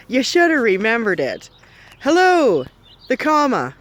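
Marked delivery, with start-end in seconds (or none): none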